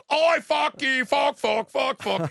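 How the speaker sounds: high, loud mock-Cockney shouting; Cockney sailor. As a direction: holler it like a hammed-up old Cockney sailor. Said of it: "talks like an old fucking Cockney sailor".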